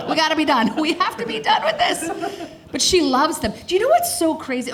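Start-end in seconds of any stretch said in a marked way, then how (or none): 0.1-2.1 higher, mock-desperate insistence; exasperated insistence. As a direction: plead it higher, hammed up for laughs. Mother acts out insisting on weaning.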